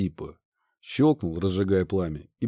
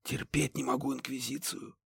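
hushed whisper of distaste; 2 semitones higher, 12 dB quieter than usual. Acted. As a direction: whisper it under your breath. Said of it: "а Банши прошептала".

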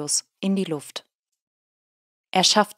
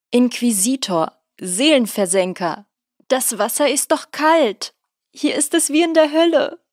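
shivering wail; 6 semitones higher, 5 dB louder than usual. A narrator's reading. Eugen wailing in cold river.

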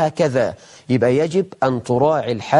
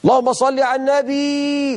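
much higher, mocking a worshipper calling out to God; mock loud invocation; 9 semitones higher than usual. Preacher mocking a worshipper who shouts his prayers, high loud call. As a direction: belt it out like a zealous worshipper in the mosque. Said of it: "أنت بتزعق ليه وأنت بتذكر ربنا".

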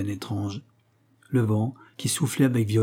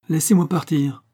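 higher, firm order; commanding tone; 6 semitones higher, 3 dB louder than usual. A narrator's reading. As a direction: command him to let you pass. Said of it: "avec le même ton de commandement". (ton de commandement)

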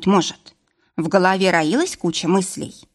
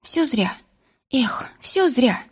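higher, hushed sigh of despair; crushed lament; 5 semitones higher, slower than usual. A narrator's reading. Варвара gives up on saving Влас, crushed lament. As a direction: draw it out slowly, sinking into a whispery lament. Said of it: "сокрушённо воскликнула Варвара".

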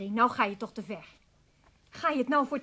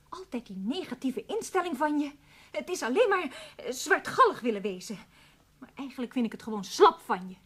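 ordinary talk, nothing marked

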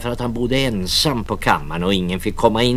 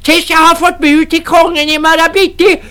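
put-on very high loud shrieking old woman; furious crone; 10 dB louder than usual. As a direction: drive them out with a screech. Crone orders men out.

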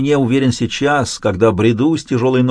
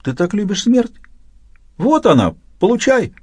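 high, taunting inner voice; 9 semitones higher than usual. A narrator's reading. Inner voice goading the narrator about death, high and taunting. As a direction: needle yourself in a raised, alien voice. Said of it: "заговорил мой внутренний голос".

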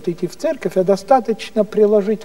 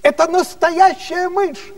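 frightened shriek; 11 semitones higher than usual. Retold or imitated. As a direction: shriek it like a panicked empress. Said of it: "императрица кричит".

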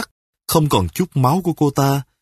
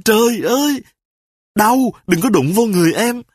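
wife crying out; 10 semitones higher than usual. Acted. A wife shrieks in pain as her husband grabs her hand. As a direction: cry out in exaggerated pain. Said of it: "thì cô ta la lên".

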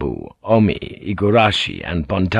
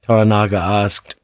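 plain reading voice

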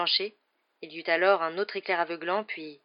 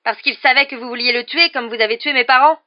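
loud, high, outraged shouting; furious accusation; 6 semitones higher, 13 dB louder than usual. A narrator's reading. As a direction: throw the accusation at him, voice raised. Harry's furious accusation continues.

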